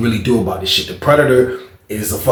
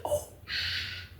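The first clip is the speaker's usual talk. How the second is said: whisper; 19 dB quieter than usual. Retold or imitated. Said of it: "I was like".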